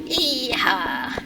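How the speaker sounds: lightly hammed cowboy whoop; a hint of a cowboy; playful mock yeehaw. Mocking redneck cheer.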